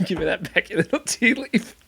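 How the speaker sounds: laughing repeat. Real, not acted.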